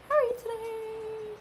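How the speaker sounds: high, slow, singsong hello; drawn-out cutesy greeting; sing-song greeting. Pretend. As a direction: stretch it out sweetly like greeting a child. Greeting repeated in put-on voice.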